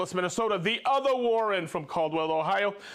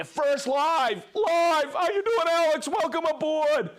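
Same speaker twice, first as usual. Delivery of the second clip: much higher and louder, bursting with glee; excited welcome; 8 semitones higher, 4 dB louder than usual. Real, not acted. Host cheering a first-time viewer: loud, high excited welcome. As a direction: throw your arms open, cheer them in.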